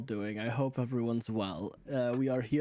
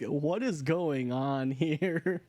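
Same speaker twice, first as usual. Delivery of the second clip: slow puzzled question; slow confused listener; much slower than usual. Retold or imitated. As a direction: drag it out, confused. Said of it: "they're gonna be like".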